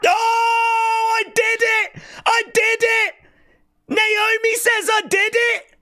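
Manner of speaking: real shriek of shock; excited shout. Real, not acted. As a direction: scream it out in disbelief at the reveal. Reacting to the confession.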